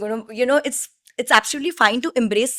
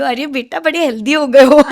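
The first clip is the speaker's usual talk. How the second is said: put-on pakistani accent; 3 semitones higher, 6 dB louder than usual. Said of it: "that auntie is only gonna come and tell you".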